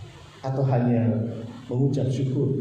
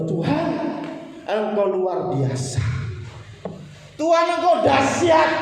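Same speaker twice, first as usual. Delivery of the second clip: praising prayer; 7 semitones higher than usual. Shown as a demonstration. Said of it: "Apakah kita berdoa".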